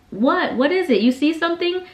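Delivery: acting out a worried mother; urgent questioning. Mother asks her pointing daughter what she sees, urgent high questioning.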